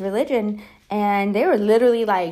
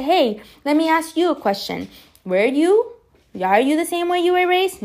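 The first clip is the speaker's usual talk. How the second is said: challenging question, building; 8 semitones higher than usual. Retold or imitated. Daughter confronting her mom in a heated argument. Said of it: "And I say".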